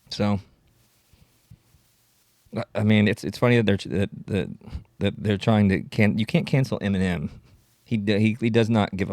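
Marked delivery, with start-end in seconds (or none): none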